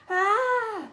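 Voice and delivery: high, loud scream of terror; terrible scream. A narrator's reading. Boy screaming in terror at the hooded figure, terrible scream. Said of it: "Malfoy let out a terrible scream".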